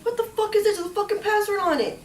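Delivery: high, loud mock outrage; irate robber. Mugger to victim over a locked phone, imitated irate outburst. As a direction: imitate an exasperated mugger, pitch shooting up.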